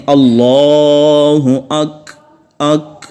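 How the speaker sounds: very slow, drawn-out call to prayer; drawn-out adhan chant. Retold or imitated. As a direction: sing it out like a muezzin. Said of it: "تو وہ یوں کہتے ہیں".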